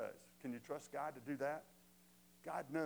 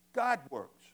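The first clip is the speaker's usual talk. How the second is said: "God works" punched out much louder; stress on GOD WORKS; 10 dB louder than usual. Real, not acted. Preacher stressing the verse's key words, emphatic "God works". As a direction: hit "God works" hard as the key point. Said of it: "And I emphasize that".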